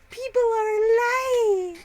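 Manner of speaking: high, loud, slow dramatic cry; mock wail. Streamer voicing a coworker's message, wailing in hammed-up despair. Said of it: "and it's just like".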